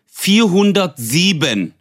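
very slow, dragged-out room number; mock slow hotel clerk reciting the number; drawn-out number. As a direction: stretch every syllable like a bored receptionist. Receptionist drawls room number.

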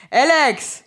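calling out. Pretend. Calling Alex to ask to be lifted, calling out.

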